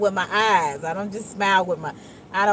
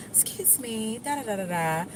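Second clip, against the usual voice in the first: soft, courteous excuse-me trailing into filler; softer polite tone; 8 dB quieter than usual. Retold or imitated. Approaching the HR lady nicely, soft polite tone. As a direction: say it gently, sketching a polite request. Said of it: "that's when my tone came a little different".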